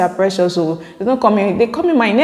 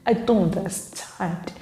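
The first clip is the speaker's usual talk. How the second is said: softly voiced, slowed-down confusion; hushed, drawn-out acted reply; mock bewildered quote; 9 dB quieter, slower than usual. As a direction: say it slow and quiet, acting lost. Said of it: "and I'll be like".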